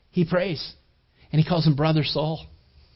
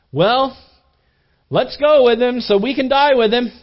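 sarcastic; 6 semitones higher, 6 dB louder than usual. Retold or imitated. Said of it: "that was very sarcastic".

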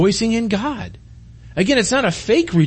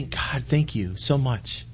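soft, slow, humbled thanks to God; bow down and breathe the thanks out slowly; a quiet, slow prostrate prayer; humble prayer; 4 semitones lower, 7 dB quieter, much slower than usual. Shown as a demonstration. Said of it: "and just say".

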